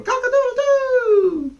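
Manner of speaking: loud high cock-a-doodle-doo; rooster crow. A narrator's reading. Wise cock crowing before roosting, loud and high. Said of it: "crowed loudly".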